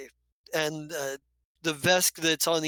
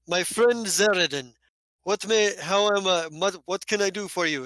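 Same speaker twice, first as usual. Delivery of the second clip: loud boisterous yes; hearty gladiator; 3 semitones higher, 4 dB louder than usual. Acted. Gladiator Hafis greeting his friend, loud and hearty. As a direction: boom it out like a showman. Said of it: "he goes".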